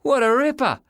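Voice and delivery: put-on high-pitched boy yelling in admiration; thrilled kid's shout; excited yell. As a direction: cheer it out high. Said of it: "yelled Sean".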